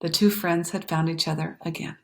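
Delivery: plain reading voice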